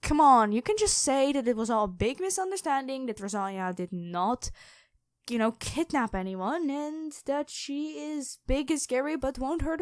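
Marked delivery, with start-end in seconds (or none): none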